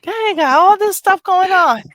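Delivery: high and loud, hammed-up amazement; playful high-pitched rave about Granny; mock excited exclaim. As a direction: gush over Granny in a put-on squeal. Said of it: "I'm like".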